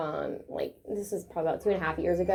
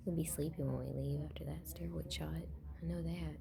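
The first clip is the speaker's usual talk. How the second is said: soft whisper to the dog; 7 semitones lower, 14 dB quieter than usual. Real, not acted. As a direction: hush it close to his ear. Owner whispers to dog at vet.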